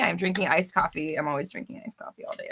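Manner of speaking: ordinary talk, nothing marked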